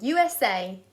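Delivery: ordinary delivery, nothing marked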